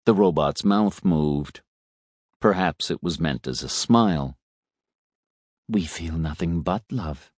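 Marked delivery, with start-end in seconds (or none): 5.7-7.3 soft robot voice. A narrator's reading.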